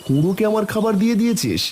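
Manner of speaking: taken aback, pitch jumping up; astonished question. Acted. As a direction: ask it in rising astonishment. Father learns food was given away.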